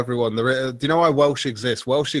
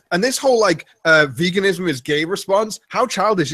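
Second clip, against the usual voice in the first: mock indignant critic; 6 semitones higher than usual. Mocking vegan critic, indignant.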